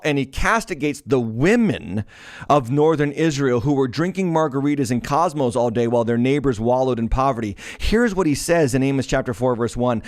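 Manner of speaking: ordinary talk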